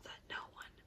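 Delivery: ordinary delivery, nothing marked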